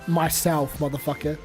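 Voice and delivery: ordinary talk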